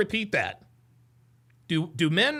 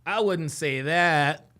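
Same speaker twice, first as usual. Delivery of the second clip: slow mock quote; 6 semitones lower, much slower than usual. Mocking the guest's line.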